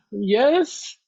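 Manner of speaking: high, startled yes; jolted out of a daydream; startled reply. Acted. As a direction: seeker caught off guard, startled. Startled seeker answers.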